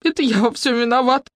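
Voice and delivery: high, sniffly female voice; tearful confession. A narrator's reading. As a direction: confess as if holding back sobs. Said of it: "В трубке зашмыгали носом".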